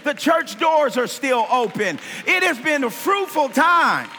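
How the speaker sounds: full-force preaching. Acted.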